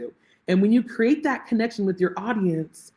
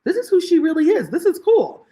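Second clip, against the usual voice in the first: delighted realisation; 10 semitones higher, 4 dB louder than usual. Retold or imitated.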